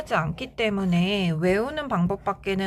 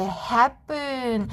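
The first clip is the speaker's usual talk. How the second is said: "happen" stressed on the first syllable; teacher's model, "happen" front-stressed; 3 semitones higher than usual. Shown as a demonstration. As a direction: punch the opening of "happen". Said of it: "강세가 앞에 오는 경우에는".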